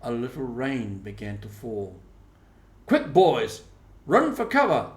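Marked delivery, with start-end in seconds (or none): 2.9-4.9 urgent call, higher and louder; boy shouting to friends to run for cover. A narrator's reading. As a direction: yell the warning to the boys. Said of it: "Tom shouted".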